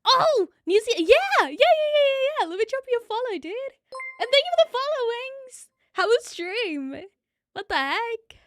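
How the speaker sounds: high, loud burst of delight; real excitement. Real, not acted. Thrilled greeting to a raiding streamer.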